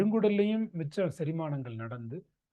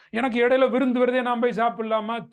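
loud, pitched-up patient's question; mimicked patient request, louder and higher; imitating pleading patient; 5 semitones higher, 6 dB louder than usual. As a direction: ask eagerly like a patient seeking permission. Acts patient asking about a feast.

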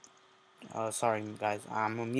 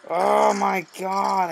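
exasperated; 8 semitones higher, 9 dB louder, much slower than usual. Real, not acted. Raging at an online match.

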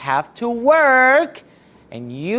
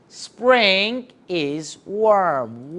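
slow model answer; much slower than usual. Shown as a demonstration. Model answer drawn out slowly.

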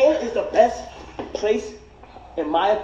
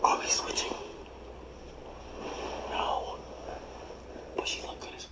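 whisper; 16 dB quieter than usual. Real, not acted. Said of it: "whispering off-mic aside".

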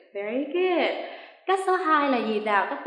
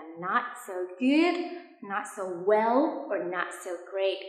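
slow, drawn-out quoted replies; slow citation; 2 semitones lower, much slower than usual. Shown as a demonstration. Teacher reminding students of the replies, slow and careful. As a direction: say each reply very slowly. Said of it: "khi mình trả lời là".

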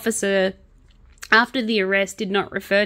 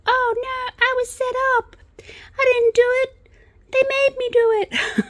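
much higher, whiny fake-innocent voice; whiny mock defendant. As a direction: squeak out the excuse like a caught kid. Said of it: "you were like".

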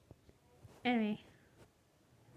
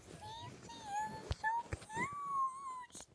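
squealed; 4 dB quieter, much slower than usual. Retold or imitated. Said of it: "That was always a Chica".

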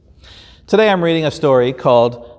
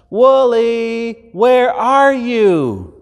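higher, slow calling out; shepherd calling; 9 semitones higher, much slower than usual. Acted. Shepherd calling for his lost lamb, slow and higher. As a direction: call out slowly into the dusk. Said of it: "He calls it by name".

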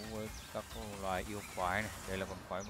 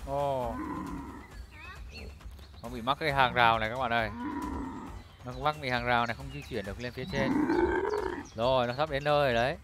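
loud excited commentary; live play-by-play; 6 semitones higher, 15 dB louder than usual. Acted. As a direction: call the monster fight loudly.

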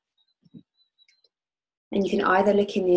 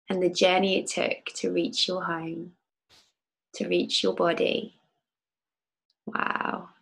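calm guide leading a visualization; guided meditation. Acted. Leads a guided oil meditation.

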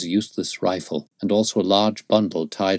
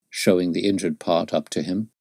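usual speaking voice

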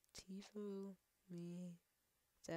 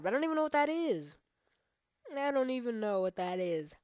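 baffled; 5 semitones higher, 5 dB louder than usual. Real, not acted. Repeats the outburst after dying.

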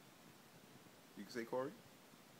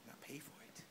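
soft, higher-pitched put-on villain threat; mock menace; 4 semitones higher, 7 dB quieter than usual. Teasing the baby with a quiet mock threat. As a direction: hiss a pretend threat at the baby.

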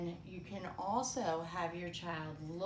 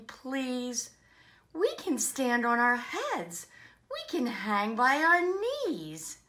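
lively, louder read-aloud voice; children's story reading; 7 semitones higher, 12 dB louder than usual. Acted. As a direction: read a rhyming picture book to children. Reading picture book to children.

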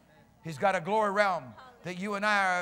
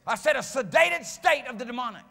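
raised, emphatic pulpit voice; full-force preaching; 5 semitones higher, 7 dB louder than usual. Real, not acted. Preacher decrying an oppressed, sedated world, forceful preaching. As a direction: drive each weighty word home.

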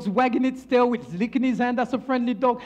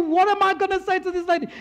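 higher, hurried worried manager; mild dismay; 7 semitones higher, faster than usual. Acted. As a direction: fret aloud at a quick pace. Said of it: "the manager feels terrible".

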